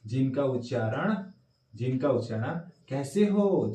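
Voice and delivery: normal talking voice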